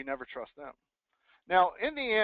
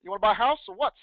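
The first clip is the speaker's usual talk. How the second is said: slangy caller; 7 semitones higher, 10 dB louder than usual. Shown as a demonstration. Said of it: "Are you using slang?".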